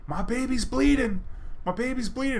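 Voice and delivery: high, frantic mother, mock; a distraught mother, imitated; imitated hysterical panic. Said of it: "she was hysterical".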